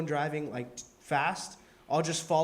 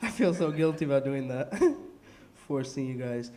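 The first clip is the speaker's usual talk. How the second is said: usual speaking voice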